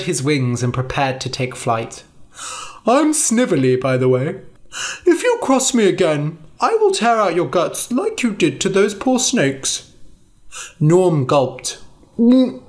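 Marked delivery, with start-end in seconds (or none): 2.9-9.9 higher, menacing dragon voice; a small dragon warning them off with a threat; dragon Snively. Acted.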